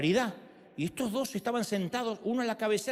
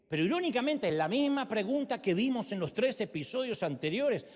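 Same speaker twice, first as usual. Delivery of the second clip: ordinary talk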